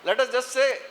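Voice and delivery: ordinary talk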